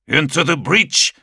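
high, loud battle cry; roaring. Acted. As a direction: roar the charge. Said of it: "roared from his helm's vox grill".

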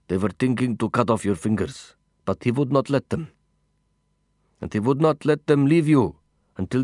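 put-on accented companion, earnest account; understated accented character voice; foreign-accented companion. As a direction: recount it earnestly in accented English. Voices Mahmoud's account in dialogue.

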